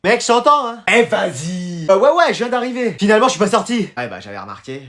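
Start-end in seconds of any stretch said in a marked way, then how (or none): 4.0-4.8 much lower, much softer grumble; sulking under the breath, lower and softer; sulky mumble. Acted. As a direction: grumble it, not pleased at all. Annoyed at his friend.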